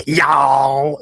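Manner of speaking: "Y'all" in an overdone Southern drawl; mother faking a Southern accent, "Y'all"; fake Southern accent. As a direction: mimic a fake Southern twang. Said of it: "the fakest Southern accent ever".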